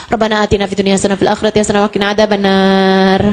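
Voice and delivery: rattled off fast and flat; a careless prayer rushed through fast. Retold or imitated. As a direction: gabble the prayer with no heart. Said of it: "Ini nih nggak serius doanya".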